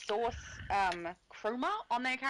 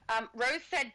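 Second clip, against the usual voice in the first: usual speaking voice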